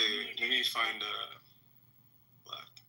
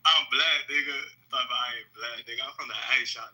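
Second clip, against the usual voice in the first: put-on Atlanta street accent; 2 semitones lower than usual. Claiming to a doubting group he's from the A, Atlanta accent. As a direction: talk like you're from Atlanta. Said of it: "this is how I really talk".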